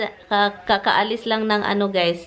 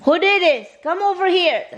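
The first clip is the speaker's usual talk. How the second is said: high, insistent summons; accusing questions and commands, pitched up; scolding pets; 9 semitones higher, 3 dB louder than usual. Real, not acted. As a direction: call the culprits over in a high scolding voice.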